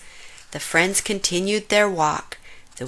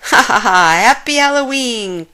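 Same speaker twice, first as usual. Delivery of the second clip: called greeting; 4 semitones higher, 8 dB louder than usual. A narrator's reading. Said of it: "called Hyena".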